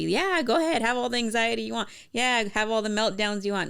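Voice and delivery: high, loud sarcastic permission, brushing it off; sarcastic high-pitched go-ahead voice; mocking misreading. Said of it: "Like, no, it's not what she's saying".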